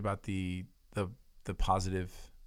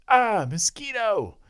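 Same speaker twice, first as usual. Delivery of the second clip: excited shout; 12 semitones higher, 7 dB louder, slower than usual. Retold or imitated. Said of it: "and he goes".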